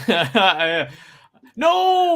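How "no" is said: dramatic cry. Acted. Reading villain's cry from comic.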